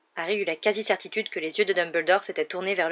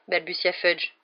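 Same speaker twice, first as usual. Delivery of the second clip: ordinary delivery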